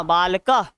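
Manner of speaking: बालकः with an h sound closing it; visarga pronunciation. Shown as a demonstration. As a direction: finish बालकः on an aspirated "ha". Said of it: "ह के समान".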